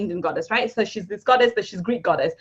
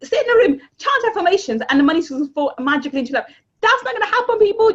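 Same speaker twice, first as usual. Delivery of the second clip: much higher and louder scoffing rant; higher, louder mocking rant at lazy manifestation; mocking exasperation; 7 semitones higher, 4 dB louder, faster than usual. Real, not acted. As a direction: ridicule the sit-and-wait crowd. Mocking the law of attraction.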